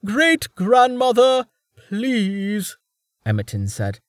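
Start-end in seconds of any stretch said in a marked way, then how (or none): 0.0-2.8 slow, wounded plea; stung and pleading; shocked and hurt. Acted. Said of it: "sounding shocked and hurt".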